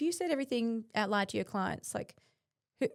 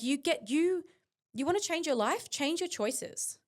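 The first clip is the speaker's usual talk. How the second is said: forceful, pitched higher, with the last words slowed; big tone; 7 semitones higher, slower than usual. Real, not acted. Urging listeners to own their choices, with a big forceful tone. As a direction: drive the point home like a coach. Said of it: "I said that with a big tone".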